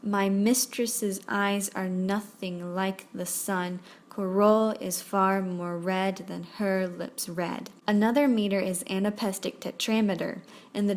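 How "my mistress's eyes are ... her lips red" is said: slow verse, every second syllable stressed: "my MIS-tress's EYES"; measured iambic recital, "are NOTH-ing LIKE the SUN". Shown as a demonstration. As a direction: beat out the iambs slowly, da-DUM, from "My mistress's eyes". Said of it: "each with an unstressed and stressed syllable".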